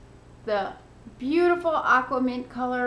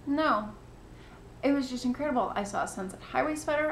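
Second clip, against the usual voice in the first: usual speaking voice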